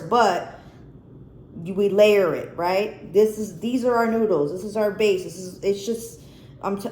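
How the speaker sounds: ordinary talk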